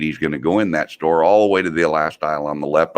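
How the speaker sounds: ordinary talk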